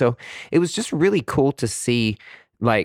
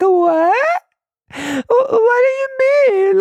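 crying, squeaking way up high and louder, dragging it out slowly; tearful shock; 7 dB louder, much slower than usual. Retold or imitated. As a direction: sob out your disbelief at the nurse. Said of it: "Like, in tears".

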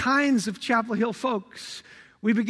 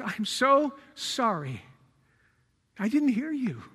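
soft apology; 5 dB quieter than usual. Retold or imitated. His apology to injured wife.

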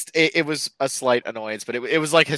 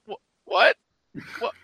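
shocked disbelief; 11 semitones higher than usual. Retold or imitated. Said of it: "and I'm like".

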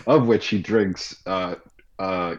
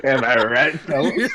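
mock bro voice; 5 semitones higher, 3 dB louder than usual. Mocking bro voice, joke.

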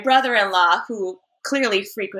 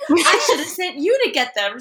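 laughing retort; 7 semitones higher than usual. Retold or imitated. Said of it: "my sister was just like".

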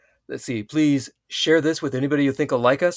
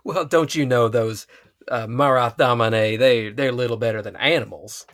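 hammed-up Texan accent; Texan drawl. Co-host plays a Seanchan disdaining damane, Texan drawl. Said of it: "the Seanchan are Texas".

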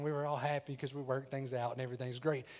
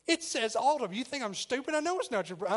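higher, louder imitation of an accusing girlfriend; mock ticked girlfriend; 8 semitones higher, 4 dB louder than usual. Imitating his girlfriend accusing him over the caller named Autumn, mock angry. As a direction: play her suspicious and fed up. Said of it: "she's starting to get a little ticked".

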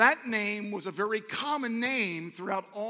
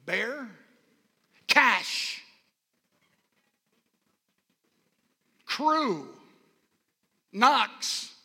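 loud, raised-pitch preacher delivery; emphatic preaching; 3 semitones higher, 9 dB louder, slower than usual. Real, not acted. Preacher listing standout names to the congregation, emphatic and loud. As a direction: proclaim each point to the congregation.

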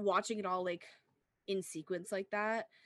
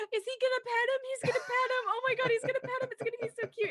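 high, loud, gushing and speeding up; excited squeal; 5 dB louder, faster than usual. Retold or imitated. Retelling her reaction to the petting scene, excited. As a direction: gush over something adorable. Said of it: "I was like".

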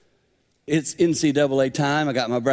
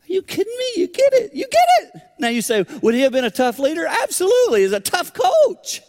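much higher and louder, exclaiming; excited preaching; 5 dB louder than usual. Real, not acted. Preacher praising Wooden to the crowd, higher and louder. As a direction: shout the praise with joy.

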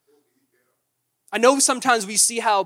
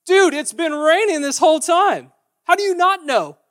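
louder and higher, disbelieving dude; incredulous; 7 semitones higher, 9 dB louder than usual. Pretend. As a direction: ongelovig uitroepen dat het al weken regent. Neighbour mocking the clueless one.

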